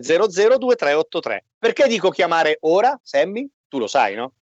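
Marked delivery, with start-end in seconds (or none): none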